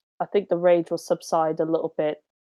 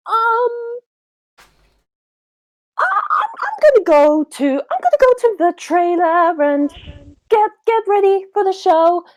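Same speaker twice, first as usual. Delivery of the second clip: very high, stammering and flustered; 11 semitones higher, 4 dB louder than usual. Acted. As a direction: stumble over the words in a panic.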